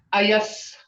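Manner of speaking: ordinary talk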